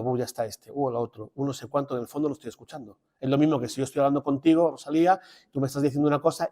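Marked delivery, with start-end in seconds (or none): 0.0-1.9 muttered mock thoughts, under the breath; mock inner grumbling. Priest mimicking a distracted churchgoer's silent thoughts, mock-muttered. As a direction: grumble to yourself while half-listening. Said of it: "en mi silencio diciendo".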